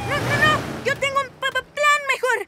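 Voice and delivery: high, loud eager stammering. Acted. Cat hero cutting in on his friend, high excited stammer. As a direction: trip over the words in a rush to pitch your plan.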